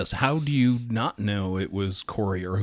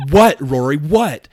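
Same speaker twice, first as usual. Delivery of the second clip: loud, exasperated mother snapping; mock-annoyed busy mom, louder; 5 semitones higher, 10 dB louder, slower than usual. Busy mom finally snapping at Rory, exasperated. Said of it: "finally she's like".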